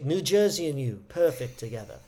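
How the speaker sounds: imitated patrician British-sounding New Jersey ad; British-sounding accent. Governor in a TV ad, mock British accent. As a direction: say it with a dropped-R British lilt. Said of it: "sounded to me like a British accent".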